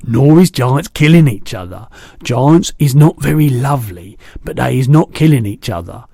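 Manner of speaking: a big kindly giant chatting; booming gentle giant; the BFG. A narrator's reading. BFG voiced.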